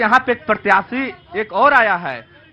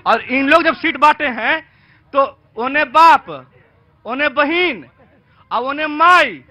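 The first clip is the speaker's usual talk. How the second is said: raised pitch, punching each item in a list; indignant rally oratory; 4 semitones higher than usual. Real, not acted. Party supporter attacking rivals' seat sharing, indignant oratory.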